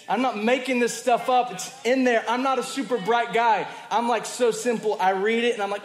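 loud, high, fervent preaching; preaching at full force. Real, not acted. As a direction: belt it out to the congregation.

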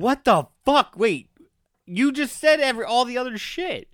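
high, loud disbelief; outraged. Real, not acted. A cohost outraged at being asked to do the recap.

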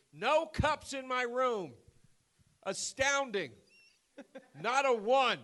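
high, slow, mock-elderly complaint; indignant old man. Old man griping to the hotel clerk about cups, high indignant mimicry. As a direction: draw it out as an outraged old man. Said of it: "this ninety-year-old man walks in".

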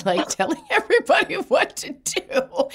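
breaking into a high laugh mid-quote; amused, laughing delivery; laughing through it. Real, not acted. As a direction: laugh as you finish the line. Laughing while quoting father.

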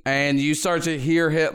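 usual speaking voice

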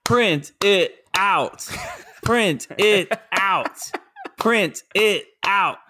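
drawn-out, evenly spaced proclamation; emphatic catchphrase. Acted. Opening his dad tip to listeners, slow emphatic catchphrase. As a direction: punch out each word slowly, with gaps.

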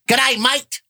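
high mock Aussie greeting to the lone listener; cheeky Australian hello; hammed-up Australian; Australian accent. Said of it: "so it's".

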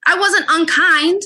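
high, rising indignant comeback; indignant retort. Retold or imitated. Guest replays her high indignant answer to critics. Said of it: "and I'm like".

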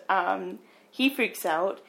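ordinary delivery

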